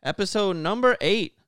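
big hype show intro; loud, punchy announcer open. Acted. Hyped podcast show opening.

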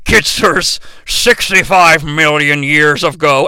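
low, slow robot-leader drone; mock-grave old commander; Optimus Prime. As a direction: intone it deep and slow. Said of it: "Optimus is like".

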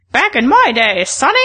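put-on creaky, high old geezer voice; grumpy grandpa scolding a youngster; very old man. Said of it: "that's how you sound".

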